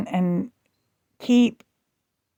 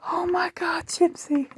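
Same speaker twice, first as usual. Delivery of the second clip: scared friend; 9 semitones higher, 7 dB quieter than usual. Retold or imitated. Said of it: "she was in tears, and she was scared".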